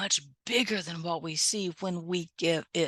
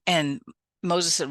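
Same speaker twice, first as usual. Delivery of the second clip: ordinary delivery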